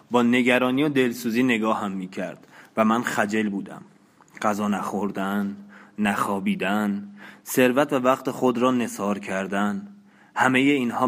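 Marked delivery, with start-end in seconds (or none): none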